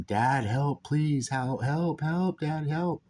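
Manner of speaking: pleading, half-voiced imitation of screams; retold screaming plea. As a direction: imitate a son yelling for his dad, without full volume. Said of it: "screaming at the top of his lungs".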